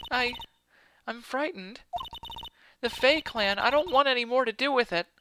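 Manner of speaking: put-on high, hesitant voice of a scared girl; frightened witness Maya. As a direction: shrink into a small high voice.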